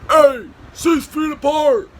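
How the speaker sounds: high, loud, stretched holler; calling out. Retold or imitated. Hidden prankster to the cops, mock-scolding holler. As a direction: heckle them from a hiding place.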